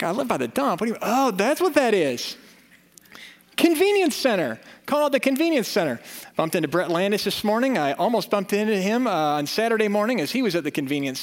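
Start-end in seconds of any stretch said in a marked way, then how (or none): 0.0-6.0 mock surprise.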